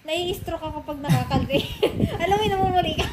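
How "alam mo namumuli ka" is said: laughing. Real, not acted. Ticklish laughing.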